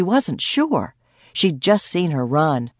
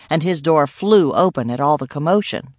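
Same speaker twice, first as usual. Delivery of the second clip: ordinary delivery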